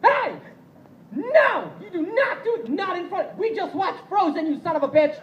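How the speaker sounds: high-pitched hammed-up shouting at the brawlers; high, loud mock outburst outside the movie theater; mock tough yell. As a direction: yell at them like a panicking hero, way up high. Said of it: "you're like".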